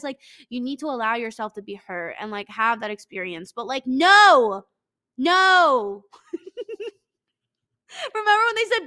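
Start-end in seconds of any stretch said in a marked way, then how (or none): 3.9-6.0 drawn-out loud refusal; emphatic no. Real, not acted. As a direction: stretch out a loud no. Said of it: "refusing loudly, slowed".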